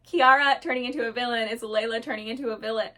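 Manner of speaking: fast, run-together rush of words; hurried, tumbling explanation; talking too fast. Real, not acted. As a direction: rattle it off too quickly. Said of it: "not talk so fast".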